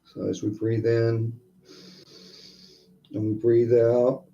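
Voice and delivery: soft, unhurried breathing guidance; guided meditation. Acted. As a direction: ease them into each breath.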